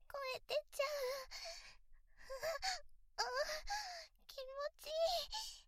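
rising, breathless moaning; breathy pleasure moan. Acted. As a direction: let the voice slip out high.

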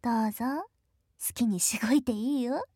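ordinary talk, nothing marked

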